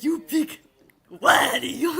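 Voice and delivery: high, loud show catchphrase; catchphrase quote. Retold or imitated. Retelling how they sprang the phrase on someone, hammed-up catchphrase. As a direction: call it out big, punching the name.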